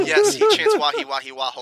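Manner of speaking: giggling through the words; laughing. Real, not acted. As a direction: laugh while you say it.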